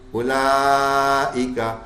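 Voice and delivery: اولائکا slow, the و silent, falling at the end; correct recitation. Shown as a demonstration. Teacher giving the correct recitation of اولائکا. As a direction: recite اولائکا slowly and properly. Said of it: "بس او".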